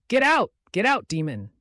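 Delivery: high, loud furious yell; angry shout. Acted. Father drives the supposed demon out, angry shout. Father grabs machete at daughter.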